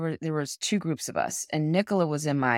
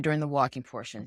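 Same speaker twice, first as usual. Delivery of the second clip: ordinary talk, nothing marked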